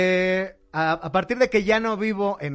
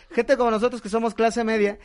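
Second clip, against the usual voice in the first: usual speaking voice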